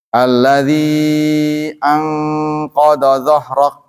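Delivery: Quranic recitation, slow and measured. Shown as a demonstration. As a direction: recite slowly, timing each sukun. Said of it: "latihan untuk mengukur". (tilawah perlahan)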